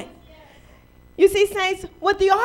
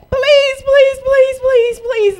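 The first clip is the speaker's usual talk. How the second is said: high, loud pleading please; loud begging; 7 semitones higher, 6 dB louder, much slower than usual. Retold or imitated. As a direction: beg loudly and over and over, high in the voice. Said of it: "be bitter and angry and say".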